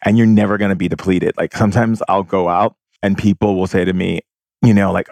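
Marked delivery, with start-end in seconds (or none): none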